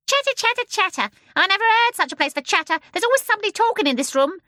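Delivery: very high, loud, fast bird voice; a scolding, chattering bird; shrill starling. A narrator's reading. The starling scolding the babies from the window. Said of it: "said a shrill voice".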